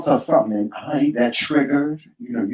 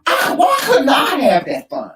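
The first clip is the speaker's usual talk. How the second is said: mock protest; 10 semitones higher than usual. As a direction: wail it as a comic grievance. Mock complaint about missing out.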